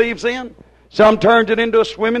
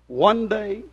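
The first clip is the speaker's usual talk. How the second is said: much softer, lower pulpit voice; soft pleading; 5 semitones lower, 7 dB quieter than usual. Acted. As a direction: plead with them gently after the storm. Preacher drops to soft pleading.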